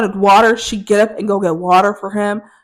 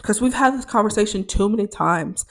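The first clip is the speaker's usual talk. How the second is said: usual speaking voice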